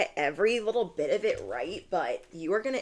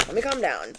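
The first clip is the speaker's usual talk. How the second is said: ordinary delivery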